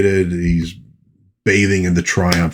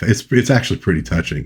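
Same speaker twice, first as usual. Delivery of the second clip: ordinary delivery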